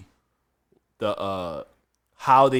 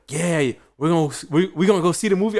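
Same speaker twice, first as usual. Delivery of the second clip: higher, faster, eager coworker voice; imitating Kevin; 6 semitones higher, 5 dB louder than usual. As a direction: pitch it up and rush it like a hyped coworker. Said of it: "and he's like".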